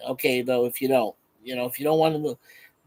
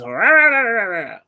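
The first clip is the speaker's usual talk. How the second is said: mock ranting; 3 semitones higher, 6 dB louder than usual. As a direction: blow off steam in a cartoon rant. Mimicking Gorilla getting worked up.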